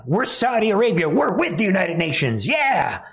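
high, hammed-up cheerleading; mock enthusiasm. Mocking Saudi Arabia backing the United Nations, falsely enthusiastic. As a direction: pretend to be fully on board.

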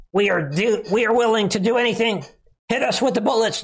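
high-pitched mocking plea; mock desperate plea. Mimicking congressmen willing to do anything, high mock-desperate plea. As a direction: plead high, hamming up the willingness.

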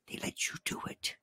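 hushed, secretive delivery of a quote. Acted. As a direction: whisper it as an aside. Re-enacting transcript, drops to whisper.

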